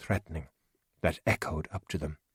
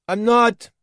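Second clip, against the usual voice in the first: much higher, much louder reply; shouted denial; 10 dB louder than usual. Acted. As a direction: call the lie back across the dark. Calling back to the hut.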